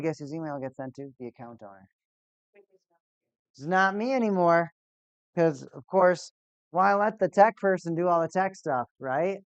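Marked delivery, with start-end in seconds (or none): 3.5-9.4 sarcastic rant. Real, not acted. Sarcastic rant about account owner.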